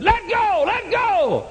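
high, loud shout of a church lady at the altar. Retold or imitated. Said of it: "one lady came by and hit me on the back and said".

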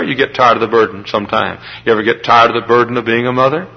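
ordinary delivery

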